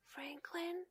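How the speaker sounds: soft, breathless whisper of a name. Acted. Bear in the dark calling his friend, whispering. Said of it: "he whispered".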